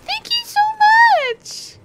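squeaky, very high thank-you; girl voice. Shown as a demonstration. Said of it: "That's my girl voice".

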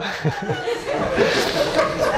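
a high, airy burst of giggling; high squeaky laugh. Real, not acted. Teacher loses it at the class joke, squeaky giggle. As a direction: squeal of laughter you can't hold in.

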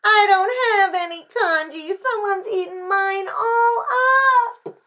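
stretched storybook whine; wailing child. A narrator's reading. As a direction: wail like an upset little kid. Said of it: "Little Chan wailed".

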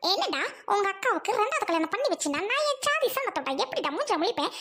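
usual speaking voice

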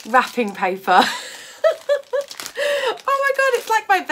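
high, loud laughing delight; excited laughter. Real, not acted. Opening a gift parcel from Anna, excited laughter. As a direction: burst out laughing in real delight. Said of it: "getting all excited".

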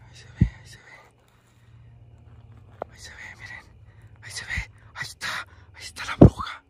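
susurro sigiloso y sin voz. Real, not acted. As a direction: susurra para que no te descubran. Acecha de cerca, evita hacer ruido. (susurrando con sigilo)

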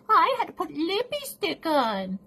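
high, put-on whiny imitation; squeaky mock excuse; mocking friend's excuse. Mimics friend's vain excuse.

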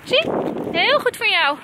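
high, encouraging call to a pet; coaxing the dog. Real, not acted. Vlogger urging the dog to come along, high-pitched coaxing. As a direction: coax them along in a high voice.

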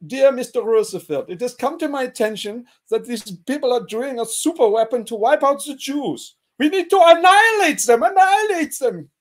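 high, loud building to annihilate; hammed-up warmonger dictating; mock letter. Einstein's letter to Roosevelt, mock bloodthirsty. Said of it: "He says, like".

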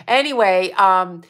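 ordinary talk

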